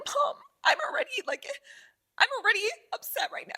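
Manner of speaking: high, fast upset plea to mom; fast, high whiny complaint to mom; upset whine. Retold or imitated. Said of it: "And I'm like".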